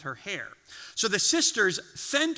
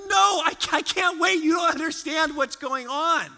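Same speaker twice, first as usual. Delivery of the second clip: desperate shout; 7 semitones higher than usual. Retold or imitated. Boy shouting at his mom that he can't wait. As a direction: yell it like a frantic child who can't wait.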